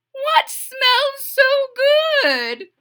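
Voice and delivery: high loud mother; excited mother. Acted. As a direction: mum thrilled by smell.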